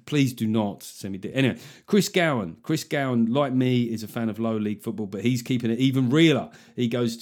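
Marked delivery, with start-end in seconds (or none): none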